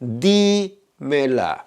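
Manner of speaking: Dímela drawn out slowly, DÍ held apart; slow teacher's demo of Dímela, accent on DÍ; stress on DÍ. Shown as a demonstration. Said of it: "I'm emphasizing the verb".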